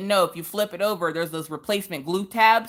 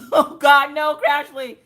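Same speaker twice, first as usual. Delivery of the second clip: higher and louder, laughing through it; laughing dismay; 10 semitones higher, 7 dB louder, slower than usual. Real, not acted. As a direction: laugh in mock horror at a viewer's comment. Reacting to chat, laughing.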